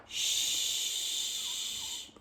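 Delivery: usual speaking voice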